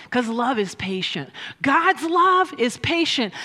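usual speaking voice

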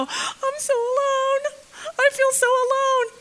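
high, whimpering mock self-pity; whiny self-pity. Preacher mocking the lonely orphan's self-pitying whine. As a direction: wail it like a pitiful lonely orphan.

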